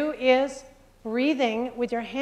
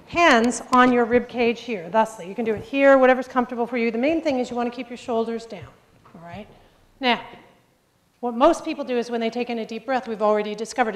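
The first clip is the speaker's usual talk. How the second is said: usual speaking voice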